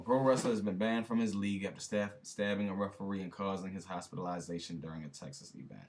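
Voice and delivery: fast, run-together headline read; rushed read. Shown as a demonstration. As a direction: rattle off the headline.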